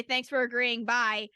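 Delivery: normal talking voice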